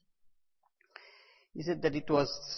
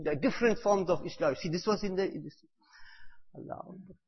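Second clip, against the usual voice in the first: ordinary delivery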